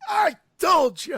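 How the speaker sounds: high, loud triumphant yell of vindication; imitating Jamie. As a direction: gloat like she was right all along, shouting. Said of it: "And Jamie would be like".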